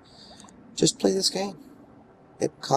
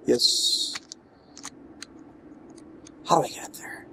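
usual speaking voice

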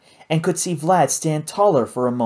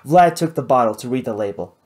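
usual speaking voice

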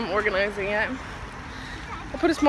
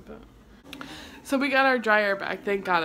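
usual speaking voice

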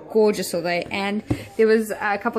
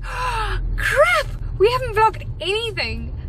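startled panic; 9 semitones higher than usual. Retold or imitated. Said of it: "I was like".

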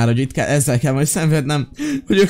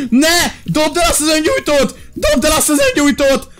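panicked screaming; 9 semitones higher than usual. Real, not acted. Child set fire with lighter. (pánikos üvöltés)

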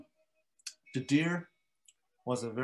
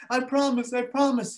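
high, loud hurried promise; lion promising the mosquito; pleading lion; 11 semitones higher, 4 dB louder than usual. Acted. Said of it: "he said".

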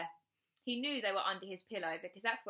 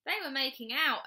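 higher, louder announcement; announcing donkey; 6 semitones higher, 6 dB louder than usual. A narrator's reading. Donkey tattling to the other animals, announcing loudly. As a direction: announce it loudly to the whole group. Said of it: "announced the Mexican donkey".

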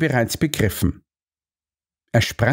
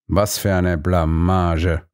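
lamenting; 4 semitones lower, slower than usual. A narrator's reading. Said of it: "jammerte er".

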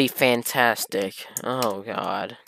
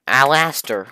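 ordinary talk

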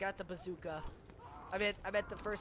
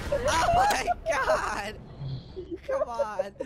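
very high, loud squeal through laughter; drawn-out squealed protest while cracking up; helpless laughter; 11 semitones higher, 6 dB louder, much slower than usual. Real, not acted. Cracking up at game mishap.

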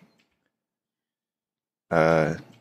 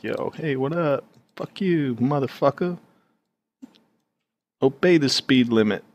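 higher, cocky trash talk to another driver; high mock tough guy; 8 semitones higher than usual. Driver yelling at another car, higher mock swagger. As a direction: sneer it up high like a road rager. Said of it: "I'm like".

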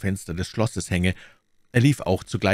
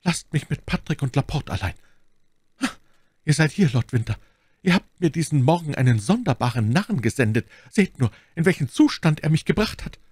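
high, strained nobleman's voice; wounded duke, reproachful; 8 semitones higher, 3 dB louder than usual. A narrator's reading. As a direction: complain from the sickbed, growing more agitated. (verwundeter Herzog, klagend)

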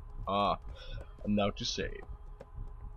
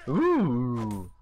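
normal talking voice

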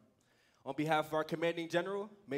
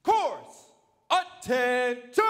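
drill command shout; 8 semitones higher, 13 dB louder, much slower than usual. Acted. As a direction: bark the command loud and long.

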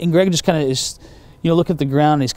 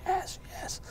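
weeping; 13 dB quieter than usual. Retold or imitated. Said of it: "she's, like, just weeping".

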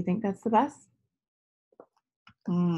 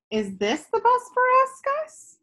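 put-on high hopeful child's question; little girl hoping again for her bus; eager little girl; 3 dB louder than usual. Girl Tess asks in story.

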